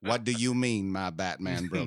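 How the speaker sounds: a hint of a cranky old man; lightly voiced grumpy old man; Uncle Ruckus voice. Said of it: "I turn into Uncle Ruckus".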